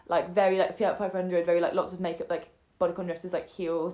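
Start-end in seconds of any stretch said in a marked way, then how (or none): none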